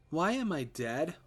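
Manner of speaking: put-on high, slow child's voice; a small boy asking, eerie and innocent; childlike question. Ghost son asking his father, slow and high.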